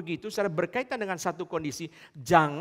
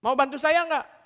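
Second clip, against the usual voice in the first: voicing foreigner; 8 semitones higher, 7 dB louder than usual. Foreigner asks youths for help.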